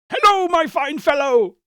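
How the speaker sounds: put-on high, loud hail to the boy; calling out.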